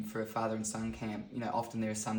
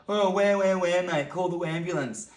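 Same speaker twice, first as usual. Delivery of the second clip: high, loud mock wailing; teasing fake cry-baby wail; mocking schoolmates; 6 semitones higher, 7 dB louder than usual. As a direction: jeer at a friend for whining. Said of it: "they're like".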